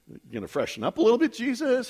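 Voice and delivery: quick, playful aside with a rising end; lightly mock a puzzled disciple, quickly; joking question. Disciple teasing Jesus before the boat trip, joking question.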